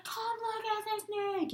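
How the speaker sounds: high, slow, drawn-out excited call; excited kid. Retold or imitated. Dudley's friend calling everyone over, high and excited. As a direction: call out to the others, thrilled. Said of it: "and he's like".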